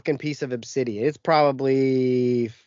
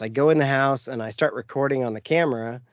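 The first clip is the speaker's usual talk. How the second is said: normal talking voice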